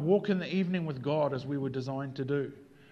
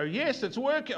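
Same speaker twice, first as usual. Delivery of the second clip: mock delight; 9 semitones higher than usual. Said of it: "Sometimes I'd go".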